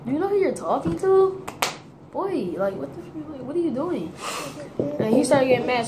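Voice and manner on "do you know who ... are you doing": high-pitched, incredulous challenge; retold disbelief, not shouted; mock indignant comeback. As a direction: act out being offended, pitched up.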